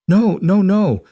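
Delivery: high, loud, sobbing refusal; a mother pleading through tears; crying protest. A narrator's reading. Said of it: "Nora was crying now too".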